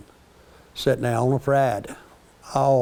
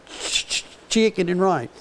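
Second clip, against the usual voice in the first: breathy ch- stammer before chicken; lightly voiced kid stammering the dish; a touch of a child's stammer; mild child stutter; 5 semitones higher than usual. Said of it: "he kinda stutters a little bit".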